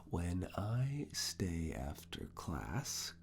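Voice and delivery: drawn-out, measured question; slow dictation. Acted. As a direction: stretch the words out for note-taking.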